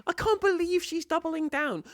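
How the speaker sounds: high-pitched, incredulous exclamation; stunned disbelief. Real, not acted. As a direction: marvel at it in a high voice. Still stunned by the clip.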